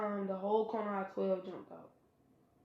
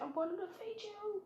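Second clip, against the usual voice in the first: put-on much higher, softer girlish threat; lightly voiced girl making a feeble threat; girlish vow; 12 semitones higher, 9 dB quieter than usual. Hinata vows revenge.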